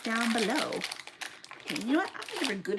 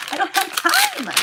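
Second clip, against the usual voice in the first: pitched up high and loud, frantic; stressed; 11 semitones higher, 7 dB louder than usual. Real, not acted. Vlogger behind on packing, frantic about time. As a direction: blurt it out in a rush of stress.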